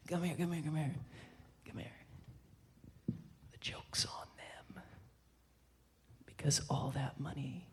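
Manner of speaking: very hushed, confiding near-whisper; conspiratorial whisper. Retold or imitated. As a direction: draw the listener close and whisper the secret. Friend confides a secret, beckoning closer.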